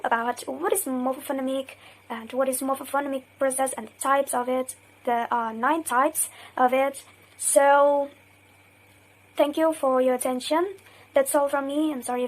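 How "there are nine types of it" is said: usual speaking voice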